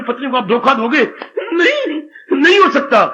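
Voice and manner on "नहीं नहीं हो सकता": emphatic refusal. Acted. Acted penitent refusal.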